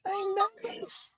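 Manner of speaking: high squeaky voice changer; slow high chipmunk voice; Little Shane voice. Pretend. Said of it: "when he does Little Shane's voice".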